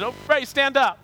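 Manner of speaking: high, loud imitation of a pastor; mock calling out. Pastor telling a shy visitor to stand, mock calling out. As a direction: call a visitor up from the pulpit.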